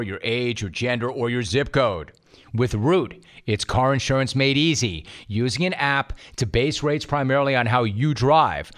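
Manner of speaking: upbeat sponsor ad read. Acted. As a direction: sell it like a radio spot. Host reads sponsor ad.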